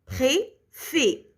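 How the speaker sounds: "Préfet" in two detached syllables; "Préfet" sounded out as pré, then fè. Shown as a demonstration. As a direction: separate "Préfet" with a pause after pré. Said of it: "La deuxième syllabe".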